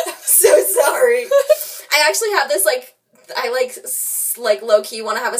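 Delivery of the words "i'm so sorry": slow, louder words through laughter; laughing apology. Real, not acted. Apologizing for the insult cards, laughing. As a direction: laugh helplessly while apologizing.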